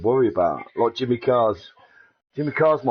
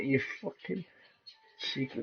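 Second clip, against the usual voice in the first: thought to himself; 13 dB quieter than usual. Retold or imitated. Inner thought, quieter.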